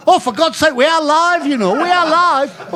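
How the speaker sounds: loud, high-pitched mock outrage; exasperated scolding. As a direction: imitate a scandalised radio host protesting. Retold interviewer scolds after rude answer.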